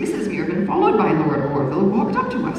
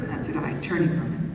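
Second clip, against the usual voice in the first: plain reading voice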